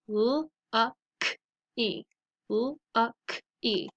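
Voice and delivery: "lucky" sound by sound, l, u, k, ee; segmented phonics "lucky". Shown as a demonstration. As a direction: sound out "lucky" twice with gaps. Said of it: "So let's practice them".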